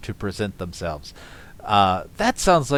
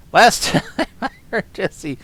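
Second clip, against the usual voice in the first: laughing through the words, higher and louder; 9 semitones higher, 7 dB louder than usual. Real, not acted. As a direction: breaks into a laugh mid-line. Laughing while reading.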